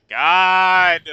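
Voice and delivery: loud, high exasperated shout; furious outburst. Acted. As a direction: blow up at the court. Cornered witness explodes.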